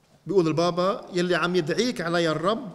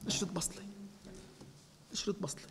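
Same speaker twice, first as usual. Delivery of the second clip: much softer, almost to himself; hushed repeat; 16 dB quieter, faster than usual. Real, not acted. Priest echoing his image softly to the congregation. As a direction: let the phrase sink to a hush.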